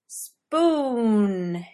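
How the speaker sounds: one long, stretched-out word; drawled. A narrator's reading. Merlin cuddling up to his partner, drawling. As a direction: drag the vowel out lazily. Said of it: "he drawled".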